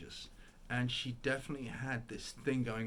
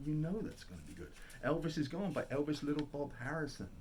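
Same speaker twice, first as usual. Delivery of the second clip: ordinary delivery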